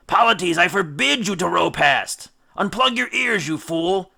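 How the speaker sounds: high, loud angry yell; furious command. Acted. Captain screams at Polites to unplug his ears, furious. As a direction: rage at the deaf rower. Odysseus ordering crew furiously.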